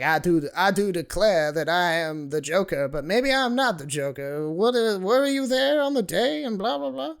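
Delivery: put-on high, loud Southern courtroom lawyer accent; Southern country lawyer accent. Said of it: "a Southern country lawyer accent".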